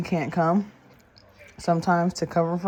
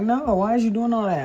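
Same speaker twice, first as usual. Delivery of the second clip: higher, louder mock outrage; retold daughter's indignant protest; exasperated kid; 7 semitones higher, 5 dB louder than usual. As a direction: imitate a kid fed up with her mom. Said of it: "She's like".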